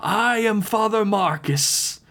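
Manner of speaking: high, slow, grandiose announcement; cult-leader proclamation. A narrator's reading. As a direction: proclaim your title to the whole hall. Cult leader introduces himself to captives.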